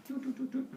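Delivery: soft, high toots; imitated phone dialing. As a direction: mimic phone tones by mouth. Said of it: "And Zeus calls back".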